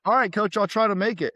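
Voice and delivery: meek high reply to the coach; timid freshman. Retold or imitated. Freshman answering the stern throws coach, meek and high.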